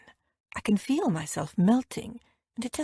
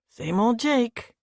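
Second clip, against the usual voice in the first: slow, cool and dismissive; a touch of coolness, unhurried; note of coolness; 2 semitones higher, slower than usual. A narrator's reading. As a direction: let a chill creep in. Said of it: "a note of coolness in Brad's voice".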